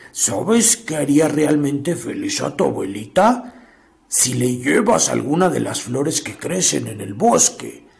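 low, soft cunning wolf; sly wolf voice. Acted. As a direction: coax her like a sly wolf. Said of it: "astutamente le sugirió".

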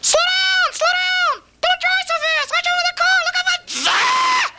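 mother's voice. Retold or imitated. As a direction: squeal like a terrified mom in the passenger seat. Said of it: "Now it works like this".

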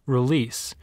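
ordinary delivery

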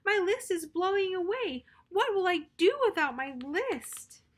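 storybook panic; worried cry. Acted. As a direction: fret loudly about the lost list. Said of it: "cried Toad".

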